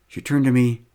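usual speaking voice

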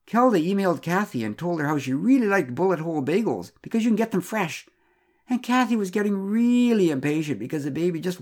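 high-pitched, pleading excuse; storyteller voicing a flustered husband; defensive explaining. A narrator's reading. Husband defensively explains his absence.

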